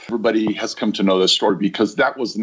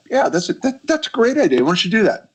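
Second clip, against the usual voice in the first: much higher, eager group agreement; mock enthusiasm; 11 semitones higher than usual. Team cheering on the idea, imitated with high-pitched enthusiasm. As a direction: imitate colleagues jumping on an idea. Team's eager reply, acted out.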